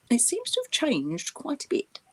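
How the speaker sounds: normal talking voice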